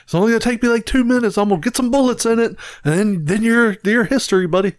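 high, mock-menacing warning to a robber; skit homeowner. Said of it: "that Jim Jeffries skit".

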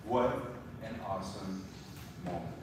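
low, very soft reverence; hushed awe. Real, not acted. Preacher to congregation after reading of Jesus' baptism, hushed and awed. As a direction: let it sink in, quietly, with long pauses.

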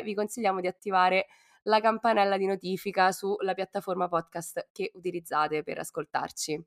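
usual speaking voice

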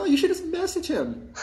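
high mock voice with a put-on sweetness; imitating the girl. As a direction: chirp it in her voice. Voicing the other girl.

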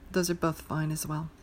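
ordinary talk, nothing marked

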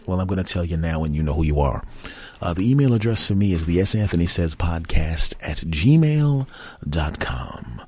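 ordinary delivery

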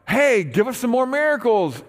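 a crowd begging for another show; eager demand. Retold or imitated. Crowd to Jesus, high loud eager demand for miracles.

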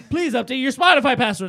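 loud, high speaker announcement; yelling Alexa. Retold or imitated. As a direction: blare it like a robot. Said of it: "she just yells".

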